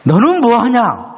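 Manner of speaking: high, slow, probing; God's challenge. Retold or imitated. God turning the question back on Gideon, high and challenging. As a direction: ask it like a rebuke. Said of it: "물어보시는 거죠".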